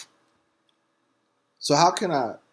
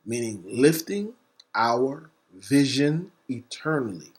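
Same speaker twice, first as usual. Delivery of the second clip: normal talking voice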